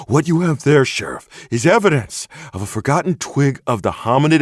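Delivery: high, loud, indignant enthusiast voice; a worked-up crank laying down his proof; excitable Bigfoot hunter. Acted. Bigfoot hunter warns the sheriff he saw everything, high indignant enthusiast.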